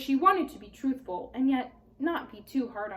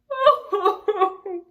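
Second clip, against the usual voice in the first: high, loud sobbing cry; 9 semitones higher, 9 dB louder than usual. A narrator's reading. Tumnus sobbing to Lucy. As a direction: let the sob break through the word. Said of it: "sobbed Mister Tumnus".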